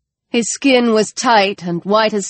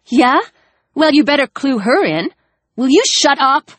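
angry retort; 7 semitones higher than usual. Acted. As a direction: snap back hard.

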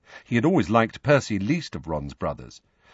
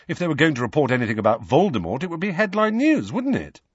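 quick, higher-pitched self-justification; defensive; 8 semitones higher, 3 dB louder, faster than usual. A narrator's reading. Harry justifies his skimming to Hermione, defensively. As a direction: rattle off the excuse, rising higher at the end.

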